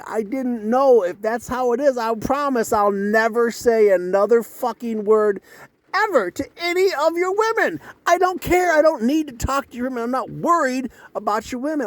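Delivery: high, heated outburst; indignant protest. Retold or imitated. Guest swearing off talking to their women, indignant. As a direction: protest at the top of your pitch, climbing as you go. Acted angry protest to the men.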